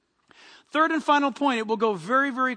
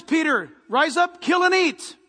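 loud, raised voice of God giving orders; the Lord commanding; 4 semitones higher, 5 dB louder than usual. Acted. The Lord to Peter in a trance, loud command. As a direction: proclaim it forcefully from on high. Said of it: "And the Lord says".

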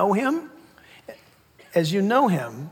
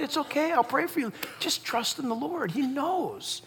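soft, higher comforting voice; gentle reassurance; 6 semitones higher, 6 dB quieter than usual. Acted. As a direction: quietly soothe a friend in distress. Acted comforting of troubled friend.

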